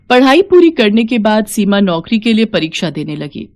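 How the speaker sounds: usual speaking voice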